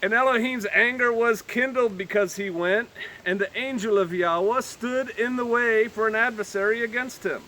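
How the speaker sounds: measured, lifted Bible reading; solemn King James reading; scripture reading. Acted. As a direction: read the verses with gravity. Reads scripture aloud.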